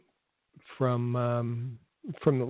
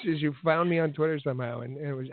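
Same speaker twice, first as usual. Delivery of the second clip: normal talking voice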